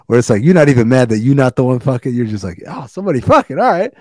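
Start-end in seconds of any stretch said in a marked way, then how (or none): 2.7-3.9 mock acceptance. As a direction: act out a mild, unbothered shrug. Mimics an unbothered bystander's reaction.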